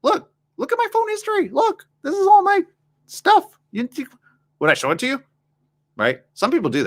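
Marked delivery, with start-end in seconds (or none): 0.0-5.2 very high, whiny, pleading; overthinking partner. Retold or imitated. Overthinker begging partner to check his phone, high and whiny. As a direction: plead high and desperate, shoving the phone over. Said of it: "They're like".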